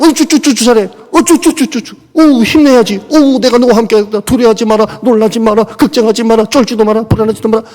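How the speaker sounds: put-on high, loud baby-talk cooing; mock coddling God. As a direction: coo like spoiling a toddler, mockingly. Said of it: "이런 하나님은 아니잖아요".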